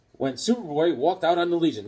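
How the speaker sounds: normal talking voice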